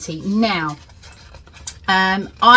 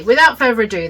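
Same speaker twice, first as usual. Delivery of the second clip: ordinary talk, nothing marked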